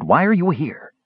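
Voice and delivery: high, insistent question; demanding. A narrator's reading. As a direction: pitch it up and demand an answer. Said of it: "Raistlin demanded".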